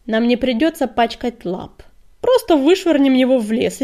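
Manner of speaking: loud, callous dismissal; scornful proposal. A narrator's reading. Deputy wants the prisoner thrown out, scornful. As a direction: throw it out forcefully, with contempt.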